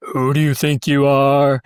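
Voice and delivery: slow, pulled-down tough-guy voice; Clint Eastwood impression. Shown as a demonstration. Voice coach demonstrating a practice phrase, slow Clint Eastwood voice. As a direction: pull it real down, drawn out. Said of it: "And then Clint Eastwood's".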